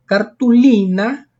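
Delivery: cartulina drawn out on a strong LI; slow down and lean on LI in cartulina; stress on second-last syllable. Shown as a demonstration. Teacher showing cartulina is grave, stressed LI. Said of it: "es una palabra grave".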